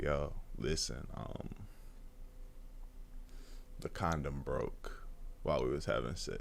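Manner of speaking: whispered, scared telling her the condom broke; hushed confession. Retold or imitated. Teen boy whispering bad news to girl, scared hush. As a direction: breathe it out like a terrified secret.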